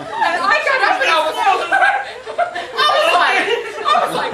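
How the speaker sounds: loud, high telling through real laughter; helpless laughter breaking up the words; cracking up. Real, not acted. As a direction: fight through the giggles to get it out. Laughs hard telling of being pushed.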